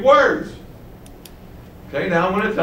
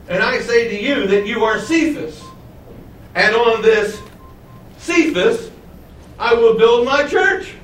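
"Cephas" lifted high and held apart; stress on Cephas; 3 semitones higher than usual. Shown as a demonstration. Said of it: "it read like this".

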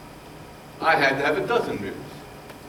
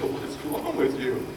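soft, slow, breathy question to himself; hushed wonder; 4 dB quieter, slower than usual. Retold or imitated. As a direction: ask it softly, slowly, amazed. Voices his wonder at himself.

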